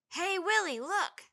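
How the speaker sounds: put-on a boy calling out loud and high; shouting. A narrator's reading. Boy calls to Willie from below, loud high shout. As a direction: yell up to your friend. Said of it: "someone shouted from below".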